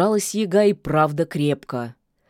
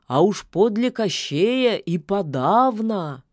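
put-on raspy old voice; 4 semitones higher than usual. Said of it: "продолжил".

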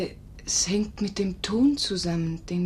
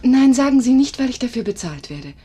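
higher, much louder cry of no; eager protest; 5 semitones higher, 10 dB louder than usual. Acted. Nurse loudly protesting to old master. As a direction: burst out to stop him.

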